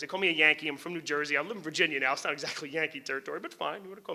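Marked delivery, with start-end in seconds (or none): none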